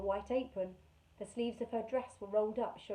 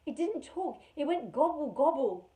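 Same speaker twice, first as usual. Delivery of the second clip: put-on scared girl; 6 semitones higher, 7 dB louder than usual. Carrie imitating the gobbling noise.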